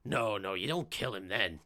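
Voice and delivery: a low, menacing gangster voice; lightly voiced menacing gangster; menacing villain. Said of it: "you hear him go".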